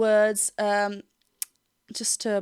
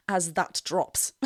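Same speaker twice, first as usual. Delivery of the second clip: AS THAT DROPS slowed and lowered; dropping voice; 4 semitones lower, 4 dB quieter, slower than usual. Shown as a demonstration. Coach miming the singer's drop, voice sinking. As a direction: let the voice sink slowly through the phrase.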